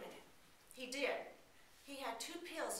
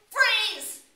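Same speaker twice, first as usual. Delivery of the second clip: loud, high robbery yell; hammed-up stickup shout; robber shouting; 8 semitones higher, 16 dB louder, slower than usual. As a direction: bark the demand at the cashier. Robber bursts in with pistol.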